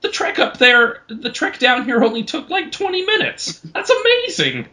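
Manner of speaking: impressed halfling. Acted. Halfling calls up from Samson's pocket, high and amazed. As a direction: squeak it out, half awed, half annoyed. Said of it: "Super impressed and somewhat angry".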